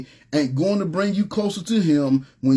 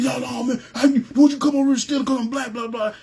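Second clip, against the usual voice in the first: higher, hammed-up protest of an accused man; mock indignant shopper; 9 semitones higher than usual. Retelling the man's outburst at the store, mock indignant and higher. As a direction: play the upset guy, higher and defensive.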